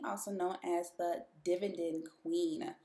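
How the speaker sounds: normal talking voice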